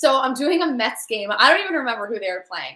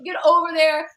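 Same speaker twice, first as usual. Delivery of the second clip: ordinary talk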